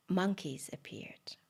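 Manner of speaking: plain reading voice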